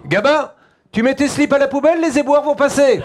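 loud, high-pitched parental yell; yelling at son. Acted. Father ordering his son about dirty underwear, loud yell. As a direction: holler at your son across the house. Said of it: "on leur parle pas de la même façon".

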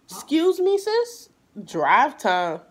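high, incredulous mock of the driver; high-pitched, sassy disbelief; sassy bus driver. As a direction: ask it like she can't believe you. Mocking bus driver's response.

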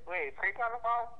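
ordinary talk

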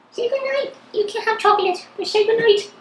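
high squeaky dog voice; voicing the dog. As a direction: speak for the puppy, very high. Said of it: "That was him saying goodnight".

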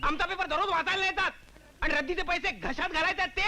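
indignant, high-pitched retort; भांडखोर, चढा आवाज. Acted. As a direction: argue back with the pitch right up. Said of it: "हळू बोला, हो". (चढ्या आवाजात उलट भांडण)

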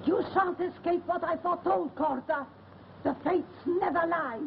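high, chanting curse; ominous curse. Acted. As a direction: cast the curse at him.

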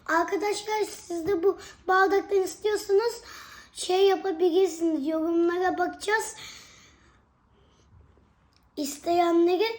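bright, loud address to viewers; loud vlogger voice. Real, not acted. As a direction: call out to the fans.

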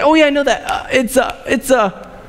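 high, eager start that stalls into "uh" hesitations; fumbling for words. Retold or imitated. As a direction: sound sure, then stall on "it's, uh". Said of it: "I was like".

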